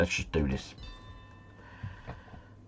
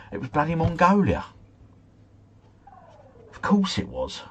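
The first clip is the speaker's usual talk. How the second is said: exasperated; 9 semitones higher than usual. Real, not acted. Reveal of the wrong answer.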